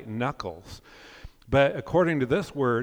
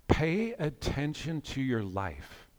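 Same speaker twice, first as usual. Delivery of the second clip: slow and hushed, each word given room; slow, soft exhortation; 5 dB quieter, slower than usual. Real, not acted. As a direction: urge them softly, taking your time. First take, slowly impressed on listeners.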